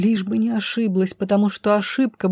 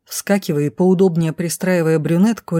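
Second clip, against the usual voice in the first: ordinary delivery, nothing marked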